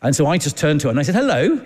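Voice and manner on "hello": high, rising sing-song hello; a bright, friendly greeting pitched way up; cheery hello. Retold or imitated. To the woman on the plane, a high, rising sing-song hello. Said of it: "like, like this to her".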